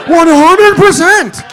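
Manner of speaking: high, slow, loud declaration; emphatic shout. Real, not acted. Comic confirms the dad's suspicion, shouting. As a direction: yell it with total certainty. Comic hammers the realization home.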